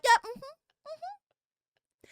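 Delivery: high, slow sing-song agreement; drawn-out polite mm-hmms in a high voice; imitating Mari. Mocking her friend Mari's polite, high drawn-out agreement. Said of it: "And Mari like".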